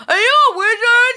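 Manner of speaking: put-on very high, loud child's voice, Boston accent; loud excited kid. Nephew asks the man at the counter, hella loud. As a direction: belt it out like an overexcited little boy. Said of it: "he's hella fucking loud".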